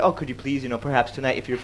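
low, soft tentative request; mock politeness. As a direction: tiptoe around it with exaggerated courtesy. Mock-polite English version of insult.

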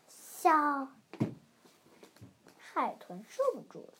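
ordinary delivery

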